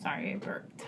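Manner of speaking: soft whispered apology; whispering. Real, not acted. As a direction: whisper it sheepishly. Quiet apology after burping.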